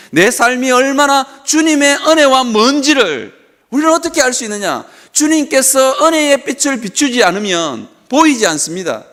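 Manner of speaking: insistent, swelling sermon delivery; impassioned preaching. Real, not acted. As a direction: press each phrase home like a preacher. Preaches in rising cadence.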